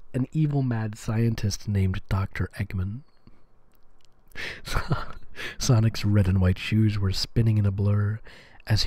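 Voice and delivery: hushed, low ASMR narration. Acted. Reads fanfic in ASMR style.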